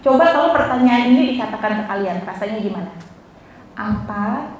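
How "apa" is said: low, soft caring question; gentle offer of help. Shown as a demonstration. Teacher demonstrates what to ask a sad friend, gentle offer. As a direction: ask softly and low, with care. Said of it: "Tanya, cuma ini coba".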